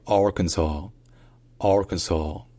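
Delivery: "Arkansas" slowly, with the stress on AR and the final s silent; "Arkansas" as AR-kun-saw. Shown as a demonstration. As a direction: hit the AR of "Arkansas", end on saw. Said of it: "l'accent tonique est sur le A".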